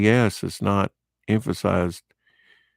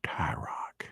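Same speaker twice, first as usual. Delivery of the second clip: whisper; 10 dB quieter than usual. Real, not acted. Whispered name over the cover.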